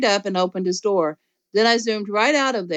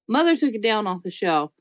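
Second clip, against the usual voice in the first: ordinary delivery, nothing marked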